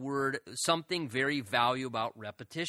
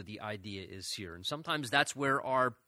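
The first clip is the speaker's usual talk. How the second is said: ordinary talk, nothing marked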